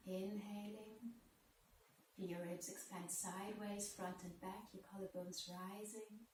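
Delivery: soft, unhurried yoga teacher voice, with long pauses; guided breathing meditation. Real, not acted.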